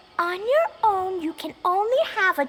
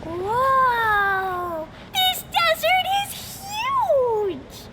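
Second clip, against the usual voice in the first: awed excitement; 3 semitones higher, much slower than usual. Acted. First sight of the vast desert.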